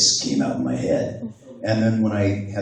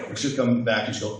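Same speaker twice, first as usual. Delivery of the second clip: normal talking voice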